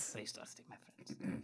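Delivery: 2 whisper the catchphrase back; 3 breathy soft echo of the sign-off. Acted.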